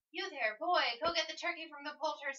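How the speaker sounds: put-on high, loud call to a boy in the street; yelling down. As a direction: shout it down from an upstairs window. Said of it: "yelling down into the street".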